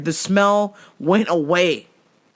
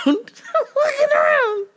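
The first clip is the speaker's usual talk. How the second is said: much higher, laughing through "looking around". Shown as a demonstration. Comic repeating the line while laughing. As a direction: laugh it out, pitched way up.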